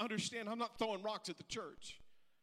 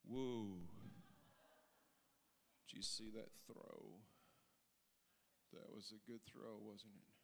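soft, lower, awed murmur to a neighbour; hushed sideline aside; 12 semitones lower, 13 dB quieter than usual. Acted. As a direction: lean in and marvel under your breath. Acted sports fan on the bench.

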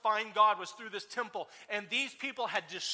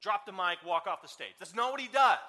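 ordinary talk

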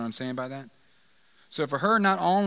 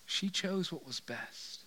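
soft, slow; 3 semitones higher, 8 dB quieter, slower than usual. Acted. Quoting Jesus, soft and slow.

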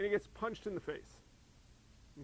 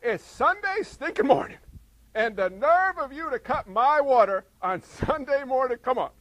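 higher, loud mock rant at God; hammed-up griping; 6 semitones higher, 10 dB louder than usual. Pastor acting out griping at God over cut-off water, hammed-up rant. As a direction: gripe like a cranky churchgoer. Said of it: "I'm gonna grumble a little bit".

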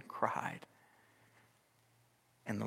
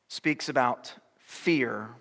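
ordinary talk

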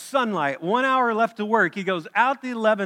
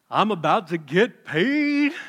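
gleeful thought; 4 dB louder, slower than usual. Pretend. Imagining the early workers' thoughts.

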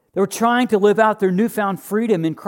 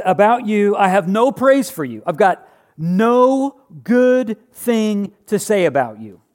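a hint of a stern apostle, louder; Paul scolding; 4 semitones higher, 4 dB louder, slower than usual. Paul rebuking the church in Corinth, mildly stern. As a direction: voice Paul's rebuke, drawn out slowly. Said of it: "he says".

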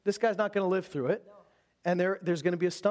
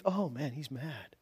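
softer and slower, a gentle shrug; sweet, soft mock reply; 5 semitones lower, 6 dB quieter, slower than usual. Preacher mocking a sweet, mild reading of the king: softly, slowly. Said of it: "it's not like a sweet".

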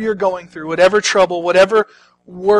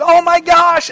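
high, loud mock amazement; screaming crowd; 11 semitones higher, 6 dB louder, slower than usual. Crowd seeing Lazarus walk out, screaming and cheering. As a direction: cry out like an astonished onlooker. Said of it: "they're screaming and cheering".

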